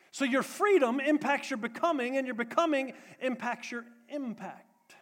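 loud, high, emphatic sermon delivery; preaching at full force. Real, not acted. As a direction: preach it out to the whole room.

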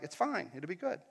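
soft, low, casual reassurance; unbothered. Acted. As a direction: brush the worry off quietly. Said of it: "Jesus was like".

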